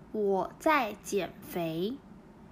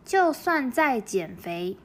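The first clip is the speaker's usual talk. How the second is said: 就算在減肥 drawn out syllable by syllable; slow model reading; 4 dB louder, much slower than usual. Shown as a demonstration. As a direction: read it slowly for learners to copy. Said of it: "なので、ここは". (ゆっくり模範読み)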